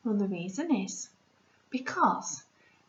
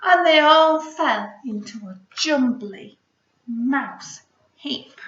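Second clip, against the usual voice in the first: louder, lively fall; tumbling climax; 2 semitones higher, 9 dB louder than usual. Acted. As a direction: make the heap tumble.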